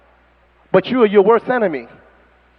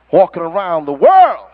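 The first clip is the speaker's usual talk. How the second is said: normal talking voice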